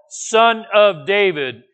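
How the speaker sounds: slow, loud, high-pitched cry; yelling. Acted. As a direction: cry it out word by word. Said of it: "to yell".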